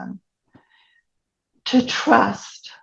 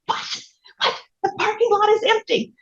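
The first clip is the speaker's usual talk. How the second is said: much higher, soft, baffled disbelief; incredulous; 13 semitones higher, 5 dB quieter than usual. Retold or imitated. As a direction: squeak out pure confusion. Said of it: "And I go".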